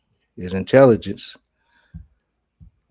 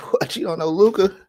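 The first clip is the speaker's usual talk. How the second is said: high, loud disbelief; mock disbelief; 10 semitones higher, 4 dB louder than usual. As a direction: act stunned that he doesn't know. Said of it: "I'm like".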